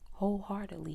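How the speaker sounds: normal talking voice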